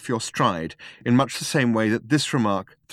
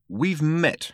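a hint of sharpness on "We've met"; sharp; 6 semitones higher than usual. A narrator's reading. Arthur interrupts the introduction, sharply. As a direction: cut in with a short, curt "We've met". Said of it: "said Arthur sharply".

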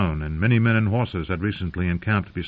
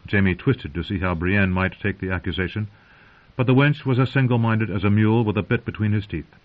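ordinary delivery

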